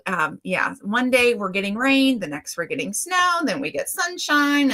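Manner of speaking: normal talking voice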